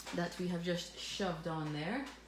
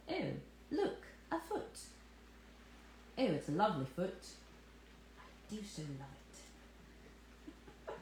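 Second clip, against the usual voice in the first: posh voice; 6 dB quieter than usual. Shown as a demonstration. Host showing off her posh voice over a foot, mock posh accent. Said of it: "This was my posh voice".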